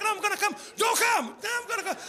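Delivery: mock shouted back-and-forth. Two voices overlapping, shouted.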